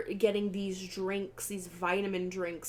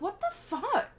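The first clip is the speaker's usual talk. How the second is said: mock shocked; 13 semitones higher than usual. Speaker's own shocked reaction.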